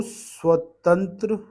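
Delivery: teacher spelling out स्व in स्वतंत्र; slow demo. Shown as a demonstration. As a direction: sound out स्वतंत्र slowly for students. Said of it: "और स्व".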